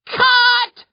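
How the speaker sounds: sharp, very high yelled call; yelling. Retold or imitated. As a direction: bark cut at the set. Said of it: "Davida yells".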